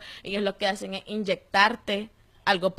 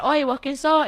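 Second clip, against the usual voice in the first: called out loudly and higher across the cells; retold shouting; 6 semitones higher, 6 dB louder than usual. Detained youths shouting between cells, loud call. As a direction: yell the question to a stranger down the corridor. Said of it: "todos empezaron a gritar".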